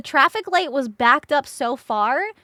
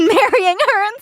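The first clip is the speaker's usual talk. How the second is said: ordinary talk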